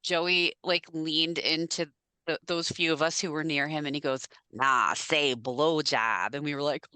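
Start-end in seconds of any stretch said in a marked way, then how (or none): 4.5-6.3 put-on slow, lower Boston-accent quote; lightly mocked Boston accent; attempted Boston accent. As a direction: imitate a Boston guy, drawn out. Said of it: "Joe said it with, like, his thick Boston accent".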